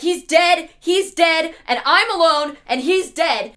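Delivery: loud, high, repeated alarm; mock panic. Eve finding Adam collapsed, in loud mock panic. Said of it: "has never seen him like this and is like".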